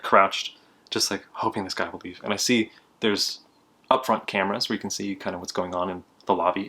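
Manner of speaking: ordinary talk, nothing marked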